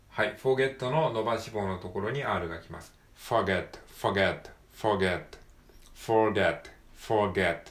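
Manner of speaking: "forget" with an R after the long vowel. Shown as a demonstration. Teacher drilling the R in forget. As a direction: curl an R into the middle of forget.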